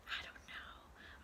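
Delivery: soft whispered aside; whispering. Acted. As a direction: whisper it playfully.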